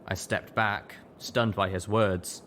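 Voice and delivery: usual speaking voice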